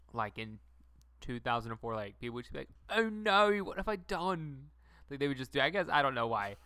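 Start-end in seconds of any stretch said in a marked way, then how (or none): 2.9-4.7 put-on British accent. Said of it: "bust into a British accent".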